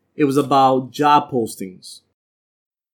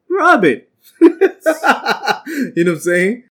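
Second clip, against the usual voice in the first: ordinary delivery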